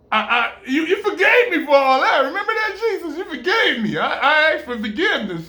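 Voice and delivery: high, laughing protest of forgiveness; laughing plea. Pretend. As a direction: plead through laughter. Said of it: "pleading with Jesus, laughing".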